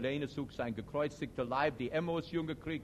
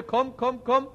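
mock call to his mate, higher and louder; calling duck; 8 semitones higher, 6 dB louder than usual. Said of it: "ruft zu seiner Gatte". (ruft wie ein Enterich nach seiner Gattin)

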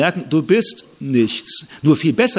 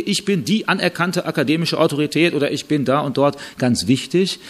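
ordinary talk

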